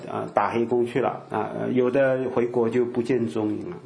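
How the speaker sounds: ordinary talk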